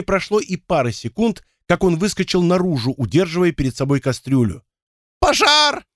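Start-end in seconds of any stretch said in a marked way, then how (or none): 5.2-5.8 very high, squealed cry of alarm; shrill squeal. A narrator's reading. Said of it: "Взвизгнув, дворф припустил по улице".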